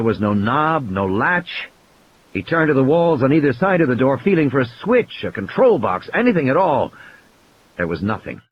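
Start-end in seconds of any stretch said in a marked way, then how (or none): none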